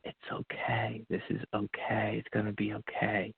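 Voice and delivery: soft, hushed reassurance; calm him like a voice inside his head; soothing inner voice. Retold or imitated. A calm voice in his head soothing him, soft and hushed. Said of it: "it was soothing".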